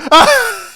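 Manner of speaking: loud, high-pitched burst of laughter; real high cackling laugh; gloating laugh. Real, not acted. Host laughing at a humbled pundit's picture, gloating laugh.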